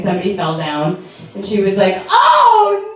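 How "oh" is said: mock gasp. Mom watching a skater fall, shocked oh no. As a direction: ham up mom's horrified gasp.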